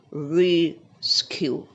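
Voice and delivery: "Rescue" in two beats, RE... skew; stress on first syllable. Shown as a demonstration. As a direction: model "Rescue", holding a stressed RE. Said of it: "stress on the first one".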